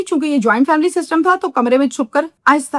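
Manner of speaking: ordinary talk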